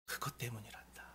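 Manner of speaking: hushed divine reply; God's gentle voice. Retold or imitated. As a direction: let God whisper the reason. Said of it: "말씀하시는 거예요".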